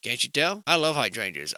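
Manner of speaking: ordinary talk, nothing marked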